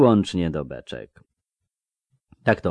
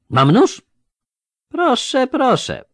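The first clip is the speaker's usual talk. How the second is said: high, loud jeering echo with a lisp; mock the threat in a high, questioning voice; lisping mockery; 6 dB louder, slower than usual. A narrator's reading. Lisping robber closing in on the boy, jeering. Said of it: "zadrwił ten sepleniący".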